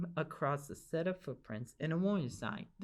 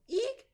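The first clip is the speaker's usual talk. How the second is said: very high, squeaky eek; old lady shrieking at the bear; frightened shriek. Acted. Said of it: "cried the lady".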